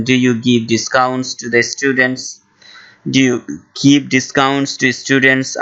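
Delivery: very slow, careful English; slow model phrase. Shown as a demonstration. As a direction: say it slowly for learners. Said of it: "ইংলিশে বলবেন". (ধীরে ধীরে স্পষ্ট উচ্চারণে)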